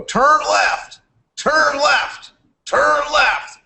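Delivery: high, mocking turn left chant; mock the endless left turns of a car race; mock race commentary. Mocking nascar to a mentor. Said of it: "Cause I was like".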